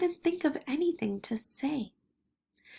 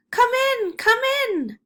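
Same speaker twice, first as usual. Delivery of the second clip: high, distant call from far off; a voice drifting in from another room; faraway voice calling; 13 semitones higher, 6 dB louder than usual. Acted. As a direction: call it in from somewhere out of sight. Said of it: "a faraway voice called".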